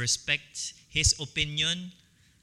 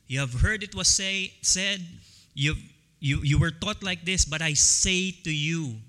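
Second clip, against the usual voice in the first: ordinary talk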